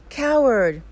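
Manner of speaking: high, loud shouted insult, falling at the end; angry scream. A narrator's reading. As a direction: hurl it at the mountain in anger. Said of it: "he screams".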